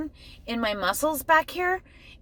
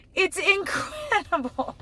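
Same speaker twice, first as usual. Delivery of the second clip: awed and slow; 10 semitones higher, slower than usual. Real, not acted.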